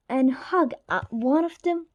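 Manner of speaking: usual speaking voice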